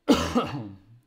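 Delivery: loud real cough; sudden harsh cough; chesty cough. Real, not acted. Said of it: "Passerà mai sta tosse?".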